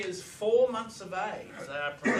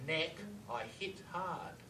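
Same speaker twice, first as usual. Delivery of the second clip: quieter, slowed reading of the reversal; soft, slow echo; 8 dB quieter, slower than usual. Retold or imitated. Quoting the killer's reversed words to the audience, soft and slow. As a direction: murmur the words as the tape plays them. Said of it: "And backwards he says".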